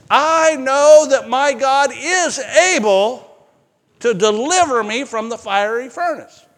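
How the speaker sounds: high, loud, stretched-out proclamation of faith; bold declaration. Acted. Condemned men declaring faith before the furnace, bold declaration.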